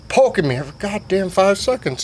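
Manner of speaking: ordinary talk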